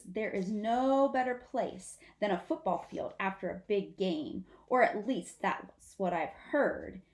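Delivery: ordinary delivery, nothing marked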